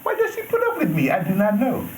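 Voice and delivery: high squeaky mock Jamaican lilt; playful fake Jamaican accent, high and bright. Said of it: "You like my fake Jamaican accent?".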